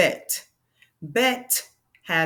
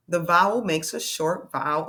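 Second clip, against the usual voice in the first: ordinary talk, nothing marked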